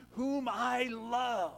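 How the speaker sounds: booming heavenly proclamation, drawn out; voice of God. Retold or imitated. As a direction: intone it slowly as God speaking from heaven. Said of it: "what did he say".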